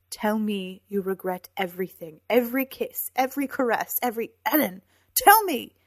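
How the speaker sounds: anguished, insistent plea; desperate demand. A narrator's reading. She demands he answer her.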